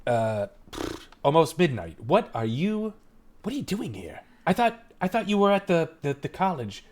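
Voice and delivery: modest brush-off turning to puzzled questioning; lilting, stop-start dad voice; father character. Acted. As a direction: fumble from small talk into questioning your daughter. Game master voices the father.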